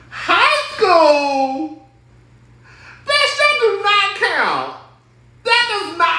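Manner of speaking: high, slow, incredulous squeal; outraged disbelief. Real, not acted. Vlogger scoffing at cheating that happened in high school, high incredulous squeal. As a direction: stretch it out in shocked disbelief.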